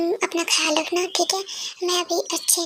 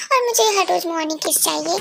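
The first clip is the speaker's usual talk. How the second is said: usual speaking voice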